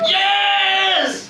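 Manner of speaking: loud triumphant yell; cheering shout. Acted. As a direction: shout it like a winning fan. Cheering at the score.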